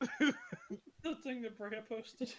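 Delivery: words squeezed out through laughter, slow; can barely get it out for laughing; real giggles breaking through the words. Real, not acted. GM cracks up recalling post.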